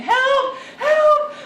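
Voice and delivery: high, loud panicked cries; mother retells son's panic to him, loud and high; imitating child screaming. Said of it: "you just started screaming".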